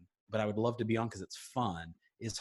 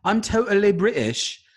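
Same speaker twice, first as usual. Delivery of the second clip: bad British accent; 8 semitones higher, 9 dB louder than usual. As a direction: insist you're British in a terrible British accent. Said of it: "do a really horrible British accent".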